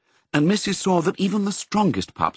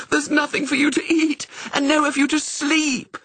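a much higher, moaning lament; moaned. A narrator's reading. The hysterical setter telling the Dalmatians there is no food, moaning. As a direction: wail it out in hopeless distress. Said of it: "moaned the poor setter".

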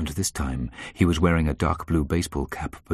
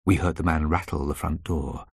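ordinary delivery, nothing marked

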